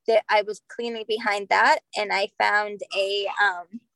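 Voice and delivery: normal talking voice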